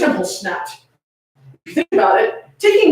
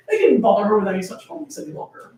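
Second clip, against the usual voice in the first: plain reading voice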